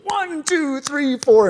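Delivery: high sing-song counting of a synchro coach. Retold or imitated. Imitating his synchro coach Debbie counting the beat. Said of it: "where she goes".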